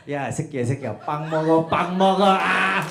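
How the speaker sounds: loud, taunting shout in Korean; mock schoolyard yell. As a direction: bark it out like rowdy classmates. Kids yelling at Korean kid.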